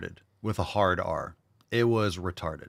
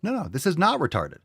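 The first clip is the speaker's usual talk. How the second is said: mock eager correction; 9 semitones higher, 3 dB louder than usual. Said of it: "You're like".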